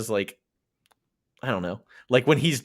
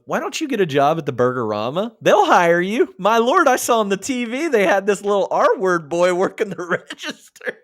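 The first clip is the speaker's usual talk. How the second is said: mock mother's voice; 9 semitones higher, 4 dB louder than usual.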